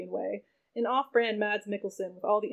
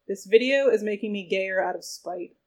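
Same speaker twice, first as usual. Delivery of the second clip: ordinary talk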